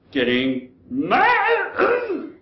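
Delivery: high, slow and strained; squeaky. Acted. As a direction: squeak it out, dragged slowly. Proposal finally blurted.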